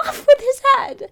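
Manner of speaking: shrill high bellow; shouting queen. A narrator's reading.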